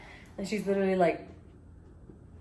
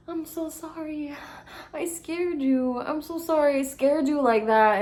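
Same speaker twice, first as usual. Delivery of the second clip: put-on apologetic girl; 8 semitones higher than usual. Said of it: "she's like".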